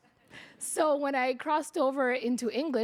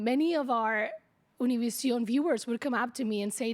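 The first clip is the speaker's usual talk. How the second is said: normal talking voice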